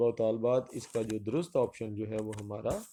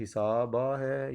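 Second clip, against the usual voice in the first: slow option reading; much slower than usual. Shown as a demonstration. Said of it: "درست option جو ہے وہ ہمارا سی ہے".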